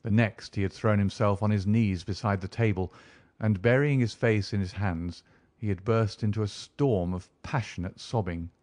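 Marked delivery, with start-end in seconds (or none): none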